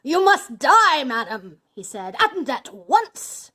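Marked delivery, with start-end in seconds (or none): 0.0-1.6 loud, slow, high-pitched outburst; drawn-out villainous shout; furious death sentence. A narrator's reading. Bluebeard pronounces death sentence. 2.2-3.5 loud, very slow and emphatic; drawn-out villainous order; furious command. A narrator's reading. Bluebeard pronounces death sentence.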